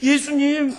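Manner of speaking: high, loud sobbing plea, acted out; imitated weeping worshipper; wailing tearful prayer. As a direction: cry out to the Lord in a Chungcheong lilt. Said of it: "엉엉 울면서 충청도 사람인데".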